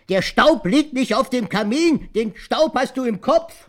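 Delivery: sehr laut und hoch, langsam nachgespieltes Brüllen; laut herausbrüllen, Wort für Wort; losgebrüllt. Retold or imitated. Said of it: "Da hab ich auf einmal losgebrüllt". (Opa spielt nach, wie er die Oma anbrüllt)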